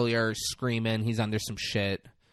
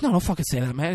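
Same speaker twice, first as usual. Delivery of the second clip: panicked protest; 6 semitones higher, 5 dB louder, faster than usual. Retold or imitated. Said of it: "He's like".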